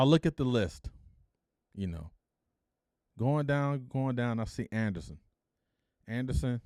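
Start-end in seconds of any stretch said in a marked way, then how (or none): none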